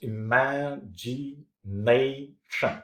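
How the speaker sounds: "imagination" stretched out slowly, syllable by syllable; teacher slowly sounding out each syllable of "imagination". Shown as a demonstration. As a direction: break "imagination" into separate slow syllables. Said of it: "look at these capitalized words".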